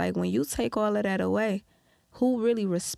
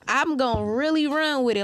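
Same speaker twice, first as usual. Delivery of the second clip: ordinary delivery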